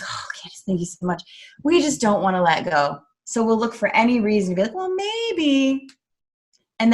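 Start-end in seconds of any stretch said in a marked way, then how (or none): 0.0-1.2 hushed, whispered gratitude; whispered thanks. Retold or imitated. Tearful client thanking her coach, whispered. Grateful client quoted after crying. 4.8-5.9 high, mock hesitant voice; hesitant excuse. People stalling on leaving, mock hesitant high voice.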